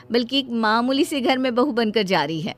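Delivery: ordinary delivery, nothing marked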